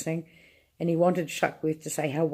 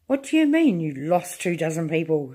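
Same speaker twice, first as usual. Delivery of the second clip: angry shout. Acted. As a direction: bark the question in disbelief. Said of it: "shouted the president".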